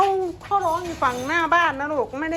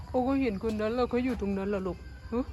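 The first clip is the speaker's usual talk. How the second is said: lightly put-on pet voice, lower and softer; voicing the dog; 3 semitones lower, 6 dB quieter than usual. Owner speaking for her dog, soft pet voice. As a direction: answer as the dog, hushed.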